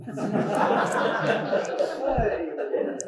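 laughs quietly, breathily; breathy laughter. Real, not acted. Teacher laughing at his own joke, breathy laugh.

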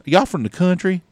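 high mimicked kid voice; twelve-year-old kid. Kid repeating his question to a woman, high mimicked voice. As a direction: pitch it up like a curious kid. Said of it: "He goes".

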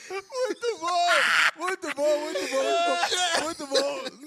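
loud high hype, slowing down; excited cheer. Real, not acted. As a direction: hype your buddy up loudly. Cheering a friend's joke. (muito bom gritado com empolgação)

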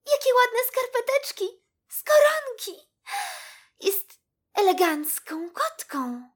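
high, loud squeaky-mouse wonder; awestruck little mouse. A narrator's reading. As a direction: gush admiringly as a small mouse. Iksi admires the new kitten. (zachwycona myszka, wysoki głosik)

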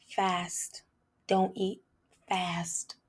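breathy whisper of a single word; whispered command. Retold or imitated. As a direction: hush it like an inner voice. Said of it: "God's instruction".

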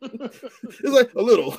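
laughing through the words; real laughter while repeating her. Real, not acted. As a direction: chuckle while quoting her.

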